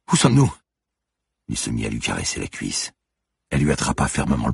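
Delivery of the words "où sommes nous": frightened question. Acted. Frightened Manon asks Turin.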